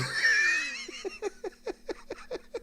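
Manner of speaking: high shout of laughter; shrieking laugh. Real, not acted. Storyteller cracking up at his own story's punchline.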